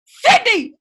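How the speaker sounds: sudden high call of a name. Retold or imitated. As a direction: yell the name out of nowhere. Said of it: "all of a sudden I hear".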